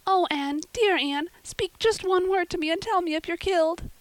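put-on girl begging her friend to speak; frantic pleading. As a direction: plead with a fallen friend to answer. Diana pleading over fallen Anne.

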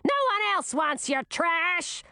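high, strident scolding scream; queen's scream. A narrator's reading. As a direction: fling the words after the junk. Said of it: "screamed the queen".

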